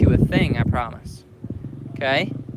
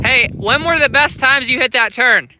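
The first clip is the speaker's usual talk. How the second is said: ordinary talk, nothing marked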